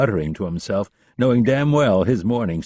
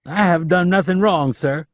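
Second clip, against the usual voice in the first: protesting; 10 semitones higher, 5 dB louder than usual. A narrator's reading. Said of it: "Leland protested".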